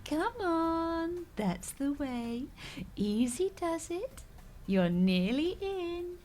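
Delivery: slow, high, soothing encouragement; slow patient pet coaxing; gentle coaxing. Acted. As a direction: ease the little creature in gently. Coaxing tortoise through door.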